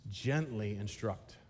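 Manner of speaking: very soft and slow, Gently instruct spoken tenderly; gentle and soft. Shown as a demonstration. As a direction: ease the words out like a soft hand on the shoulder. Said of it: "What must we do?".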